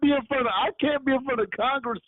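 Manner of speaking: high-pitched, insistent comic objection; emphatic protest. Real, not acted. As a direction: protest it high, full of disbelief.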